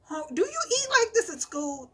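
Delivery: mock disbelief. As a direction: act shocked at the appetite. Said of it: "I'm like".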